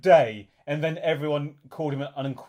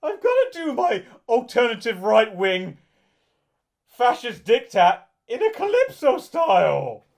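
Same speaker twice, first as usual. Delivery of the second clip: mocking voice; 7 semitones higher, 6 dB louder than usual. S1 mocking Mike Reid quote.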